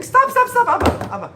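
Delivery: very high, loud frantic stop; a daughter screaming to her father, high and loud. Retold or imitated. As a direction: shriek it to halt the sword. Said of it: "she starts screaming".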